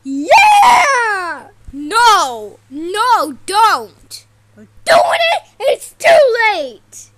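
loud, high triumphant yelling; gloating shout. Acted. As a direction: crow it loud like a gloating villain. Villains gloat loudly.

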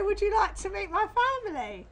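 posh English. Acted. Mother rehearsing what to say to his family, mock posh English. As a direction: put on a posh English voice for an invitation. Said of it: "And then you say".

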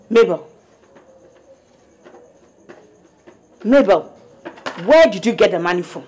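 loud, demanding the answer; furious mother questioning daughter; angry confrontation. Acted. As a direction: call her out sharply. Mother confronting daughter about money.

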